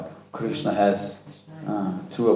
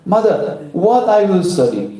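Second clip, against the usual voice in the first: put-on high, childlike query; questioning child; 7 semitones higher than usual. A narrator's reading. Son asks his mother what to study, questioning. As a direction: ask with the pitch lifted.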